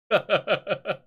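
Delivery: amused ha-ha-ha; laugh. Real, not acted. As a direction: giggle at yourself in short pulses. Laughs at own behaviour.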